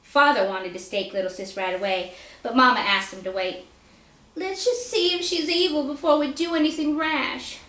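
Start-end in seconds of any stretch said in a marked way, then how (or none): 4.4-7.6 a lightly higher, cautious mother voice; mother's gentle plea. Acted. Mother pleading in family argument.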